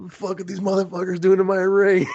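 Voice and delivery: imitated outrage, high and loud; angry ring owner. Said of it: "he looks so angry".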